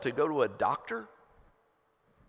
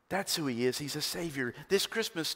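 normal talking voice

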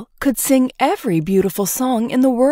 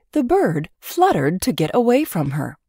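plain reading voice

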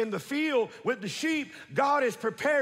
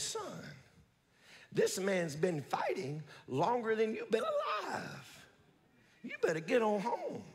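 scornful king; 7 dB quieter than usual. Retold or imitated. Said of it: "Mocked by the king".

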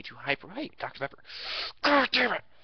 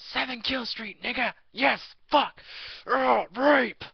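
much higher, loud screaming gamer rant; raging gamer; 14 semitones higher, 7 dB louder than usual. Retold or imitated. Overheard boyfriend yelling at his game, shrieking. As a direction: shriek like a gamer losing it mid-match. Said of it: "all you hear in the background is".

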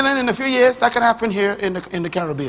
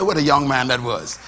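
normal talking voice

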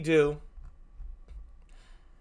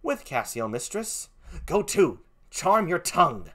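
curt villainous husband; 3 semitones higher, slower than usual. Acted.

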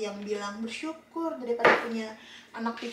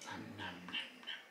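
put-on whispered nom nom to a baby; whispering; 21 dB quieter than usual. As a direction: breathe the nom noms softly. Mother feeding a baby, whispered.

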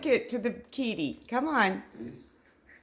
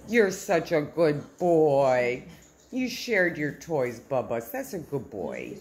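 warm praise; 8 semitones lower than usual. Real, not acted. Praising the dog.

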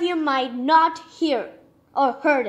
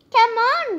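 calling out; 7 semitones higher, 6 dB louder than usual. Acted. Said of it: "they would call".

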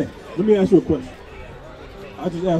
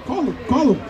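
ordinary talk, nothing marked

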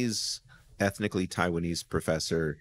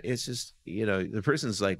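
ordinary talk, nothing marked

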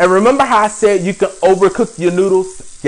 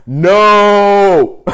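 a put-on louder no; Star voice; 5 dB louder than usual. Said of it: "In Star Voice".

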